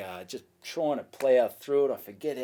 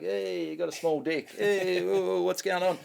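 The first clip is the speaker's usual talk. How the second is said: higher, louder heckling of a crowd member; desperate crowd work; 6 semitones higher, 4 dB louder than usual. Retold or imitated. Comic dying on stage, ribbing a punter, higher and louder. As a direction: throw cheeky insults at the crowd, pushing. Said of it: "talking to this bloke".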